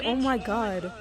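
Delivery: normal talking voice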